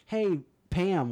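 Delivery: high, falsely friendly greeting; passive-aggressive. Retold or imitated. Angela walking up to a complaining woman, passive-aggressive. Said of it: "passive aggressive".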